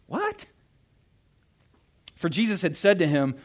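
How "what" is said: mock disbelief. Preacher's own mock disbelief.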